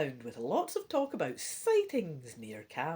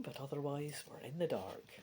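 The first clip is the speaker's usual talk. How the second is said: plain reading voice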